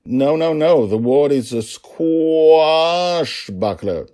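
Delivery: loud, high, hugely stretched word; over-enunciate in comic desperation; booming, drawn-out insistence; exasperated correction. A narrator's reading. Father's comically failed correction.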